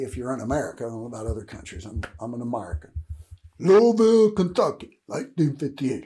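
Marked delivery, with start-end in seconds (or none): 3.6-6.0 Louisville called out loud and high, Kentucky dropping away; proud hometown call-out. Real, not acted. Speaker naming his birthplace to viewers, loud and proud. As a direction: belt out Louisville like a proud announcement.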